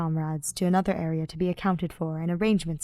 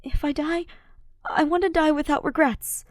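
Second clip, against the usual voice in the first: put-on higher, gentle young man's voice; Ash's earnest voice; 8 semitones higher than usual. A narrator's reading. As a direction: voice Ash pleading sincerely before battle. Ash's voice in fanfic reading.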